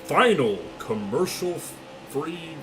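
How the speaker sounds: booming race caller, slowed down; generic commentator. Pretend. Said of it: "a generic commentator".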